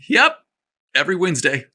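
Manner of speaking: yep squeaks up high, then settles; voice cracking. Acted. Nervous clerk answering his crush, voice cracking. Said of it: "Parker's voice cracked".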